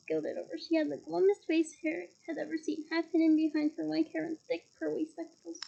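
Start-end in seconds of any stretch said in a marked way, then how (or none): none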